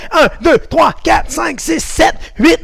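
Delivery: high, loud, rapid-fire counting. Shown as a demonstration. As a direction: drive the count hard and fast. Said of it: "Encore plus vite la dernière fois". (compte très rapide, fort et aigu)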